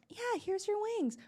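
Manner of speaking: high, slow syrupy-sweet service voice; sugary polite, with a hidden eye-roll; mock-sweet waitress handing over wings; fake sweet server. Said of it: "I will be completely sweet".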